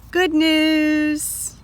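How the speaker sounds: happy. Shown as a demonstration. Teacher reading to children, delighted. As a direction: cheer it like a gift.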